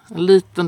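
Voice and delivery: "liten" lifted high and loud; stress on liten. A narrator's reading. Preacher stressing how small the boy was, "liten" lifted high and loud.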